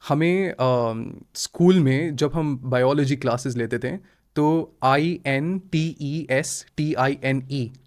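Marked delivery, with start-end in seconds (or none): 4.8-7.7 आईएनटीईएसटीआईएनई recited slowly as separate letters, like a school drill; slow school spelling-out of the word; spelled out letter by letter. Retold or imitated. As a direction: spell out each letter like a biology class. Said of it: "ऐसे हमें कैसे पढ़ाया गया था".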